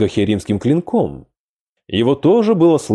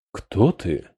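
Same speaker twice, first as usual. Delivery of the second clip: breathy; 4 semitones lower, 5 dB quieter than usual. Acted. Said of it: "с придыханием спросил он".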